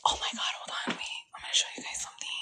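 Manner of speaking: soft, unvoiced whisper. Real, not acted. Reader about to show viewers something, whispered. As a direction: whisper it as an aside.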